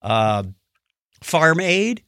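ordinary talk, nothing marked

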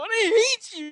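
very high, loud hammed-up outburst; mock teen tantrum. Teenage son storming off from dad, mock tantrum scream.

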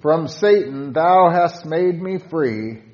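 fervent loud recitation of a hymn prayer; fervent hymn reading. Shown as a demonstration. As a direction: call out to Jesus from the heart. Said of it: "With stanza five".

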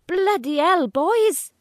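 loud, high Welsh Valleys yell; Welsh Valleys shout. Acted. Brother on the ladder shouting in a Welsh Valleys accent. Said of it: "he shouts in his Welsh Valley accent".

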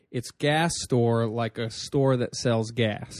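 "gas store", slow and spelled out; phonetic breakdown. Retold or imitated. Host retelling the guest's slow phonetic name breakdown. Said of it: "How do you say your name".

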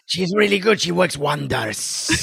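hammed-up regal recommendation; imitated Araxa. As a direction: stretch the last words grandly. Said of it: "She should be saying".

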